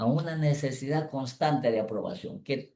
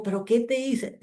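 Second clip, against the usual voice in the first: baffled question; 10 semitones higher than usual. Retold or imitated.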